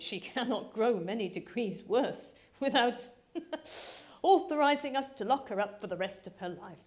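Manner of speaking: chuckling through the words; father teasing daughter about Lydia, dry laughing voice. Acted. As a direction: laugh at his own joke while talking. Father jokes about locking Lydia up.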